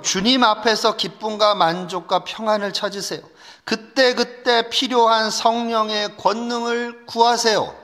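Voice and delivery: ordinary talk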